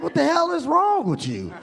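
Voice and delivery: high, incredulous mock outrage; acted exasperated protest; indignant. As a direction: demand it in high-pitched disbelief. Protests to man who booed him.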